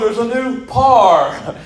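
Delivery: slow, lifted "par" in a light Irish way; playful Irish "par". Retold or imitated. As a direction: repeat "par" slowly as a fond joke. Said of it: "That's how they pronounce it in Ireland".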